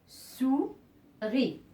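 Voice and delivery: souris broken as "sou... ris"; split into syllables. Shown as a demonstration. Teacher separating words into syllables for learners, split into syllables. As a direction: say souris with a long gap before "ris". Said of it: "je vais séparer ces mots en syllabes".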